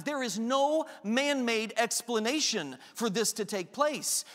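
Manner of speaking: usual speaking voice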